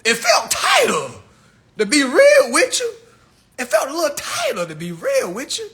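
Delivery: high, squeaky, laughing voice; laughing mock answer. Boyfriend answering his homeboy, laughing high and squeaky. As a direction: answer through giggles like a fool who got played.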